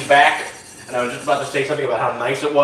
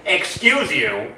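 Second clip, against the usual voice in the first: mock offended; 4 semitones higher, much slower than usual.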